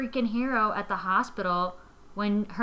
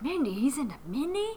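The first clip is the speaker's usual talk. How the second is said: higher, hushed jealous voice; mock jealous roommate; 4 semitones higher, 7 dB quieter, faster than usual. Roommate fuming at being mistaken for Mindy, higher and hushed. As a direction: mutter it like a jealous rival. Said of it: "she'll be like".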